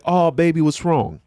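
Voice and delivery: cooing high and tender; soothing daughter. Retold or imitated. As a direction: coo over a crying baby girl.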